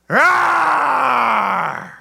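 long high roar, slowly falling; mock bear roar. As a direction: let out a big playful bear roar. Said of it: "you were growling at everybody".

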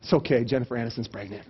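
low, soft reassurance; mock consoling. Friend consoling another with gossip, mock tender. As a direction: pretend to comfort gently.

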